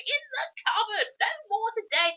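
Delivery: put-on high, tearful wail of a frightened old lady; mock sobbing old lady. Miss Tibbs emerging from the wardrobe, hammed-up crying. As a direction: sob it out high like a shaken spinster.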